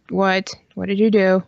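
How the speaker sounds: usual speaking voice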